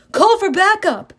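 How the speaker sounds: loud, high cop order; barking police commands. Acted. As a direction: bark the order.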